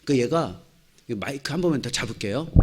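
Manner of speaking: normal talking voice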